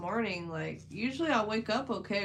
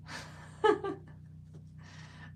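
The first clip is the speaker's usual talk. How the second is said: breathy giggle; 11 semitones higher, 4 dB quieter than usual. Real, not acted. Laughing at own early bedtime.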